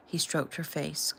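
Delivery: ordinary delivery, nothing marked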